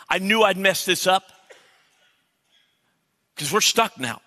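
high, loud re-enacted griping at God; complaining prayer. Pastor replaying days of prayer that was really complaining, exasperated protest. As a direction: act out an exasperated, accusing prayer. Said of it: "what I called prayer was really complaining".

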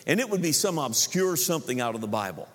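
ordinary talk, nothing marked

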